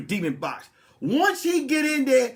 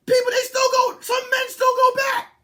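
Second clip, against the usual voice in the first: loud, high shout; mock sermon shout; shouted climax; 12 semitones higher, 4 dB louder than usual. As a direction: hammer the warning home at full voice. Preaching climax.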